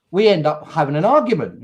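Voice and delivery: ordinary talk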